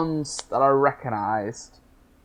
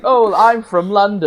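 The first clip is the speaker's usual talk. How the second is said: fake British accent; 7 semitones higher, 7 dB louder than usual. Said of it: "American trying to do a British accent".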